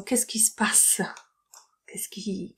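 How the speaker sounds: hushed whisper of a confused question; whispered example. Shown as a demonstration. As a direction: whisper it like you're puzzled. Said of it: "whispered example".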